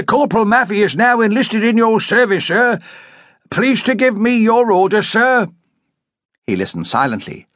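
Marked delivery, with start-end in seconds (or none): 0.0-5.5 high, crisp soldier reporting for duty; eager soldier. A narrator's reading. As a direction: salute and report keenly.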